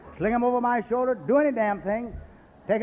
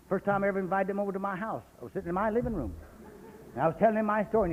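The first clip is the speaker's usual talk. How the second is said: normal talking voice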